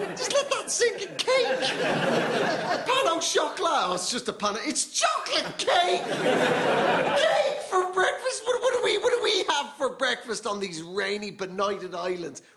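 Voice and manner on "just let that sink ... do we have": high, slow, wonder-struck cake talk; amazed. Acted. Comic marvelling at the French eating cake for breakfast, high and slow. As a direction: savour each word as if cake for breakfast were a miracle.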